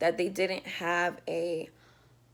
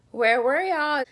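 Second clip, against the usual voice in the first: higher, louder where were y'all; indignant retort; 7 semitones higher, 8 dB louder, slower than usual. Retold or imitated. Asking the late paramedics where they were, indignant. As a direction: call them out for showing up late.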